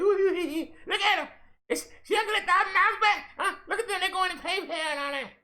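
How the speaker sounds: high, squealing mock gossip with laughs; mocking gossipers. Mocking the chatty viewers, high squealing gossip voice. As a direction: squeal and cackle like excited gossips.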